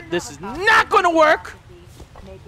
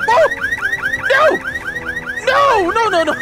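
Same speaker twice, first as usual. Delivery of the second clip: very high panicked no; panicked shriek; 4 dB louder, slower than usual. Real, not acted. Car alarm goes off, panicked shriek.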